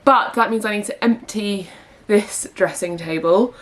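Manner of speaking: usual speaking voice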